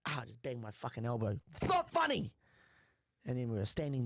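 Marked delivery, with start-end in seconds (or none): 1.5-2.3 high-pitched indignant protest; mock indignation. Real, not acted. Comic bangs his elbow and protests at the laughter, indignant. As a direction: protest sharply, pitch jumping up.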